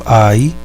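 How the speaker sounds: "ai" as one gliding diphthong; ditongo mantido. Shown as a demonstration. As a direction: say "ai" with both vowels in one syllable. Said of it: "será pronunciado como ai". ("ai" como ditongo, numa só sílaba)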